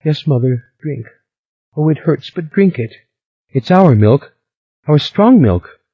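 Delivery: high, weepy coaxing; tearful girl. A narrator's reading. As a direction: urge her to drink through tears. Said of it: "the tears splattered".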